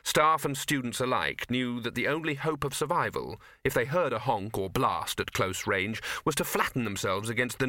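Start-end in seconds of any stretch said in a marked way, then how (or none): none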